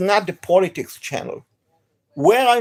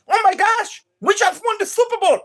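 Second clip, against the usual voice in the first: excited; 11 semitones higher than usual. Retold or imitated. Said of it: "you think".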